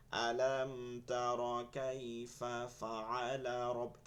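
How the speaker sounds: painfully slow, syllable-by-syllable recital; very slow recitation. Shown as a demonstration. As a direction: drag out every syllable, low. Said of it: "যদি আমি আস্তে আস্তে ধীরে ধীরে পড়ি".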